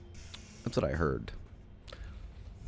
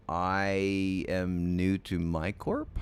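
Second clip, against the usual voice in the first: normal talking voice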